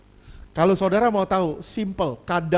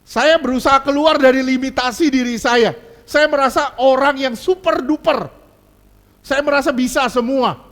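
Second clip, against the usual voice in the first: louder, higher mock bragging; boastful narcissist; 6 semitones higher, 7 dB louder than usual. Preacher playing a narcissist to church, loud mock boast. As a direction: puff up like someone super duper.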